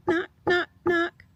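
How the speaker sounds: high, loud knock knock; playful door-knock sound effect. A narrator's reading. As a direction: knock out each word like a door. Reading a story aloud.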